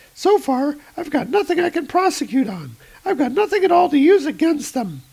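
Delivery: high, bright agent voice; high female voice. Acted. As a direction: raise the pitch for the woman agent. Agent Solomon replies.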